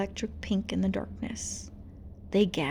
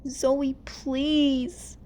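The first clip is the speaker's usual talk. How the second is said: pleading; 5 semitones higher than usual. Acted. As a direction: beg her friend. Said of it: "Rumi pleaded".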